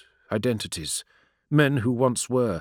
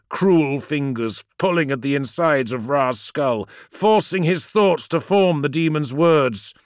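plain reading voice

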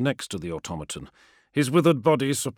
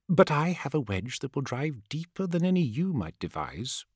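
whispery telepathic voice; 4 semitones higher than usual. A narrator's reading. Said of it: "a voice in his head".

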